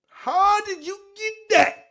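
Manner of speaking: high-pitched mimicking wail; very high put-on voice; Cornelius. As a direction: pipe it out high in disbelief. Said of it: "And Mike Cornell is funny".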